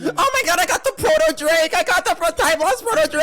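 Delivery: shrieking high-pitched mockery of a gamer; Greek. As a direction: squeal it loud in hammed-up excitement. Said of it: "you sound like fucking".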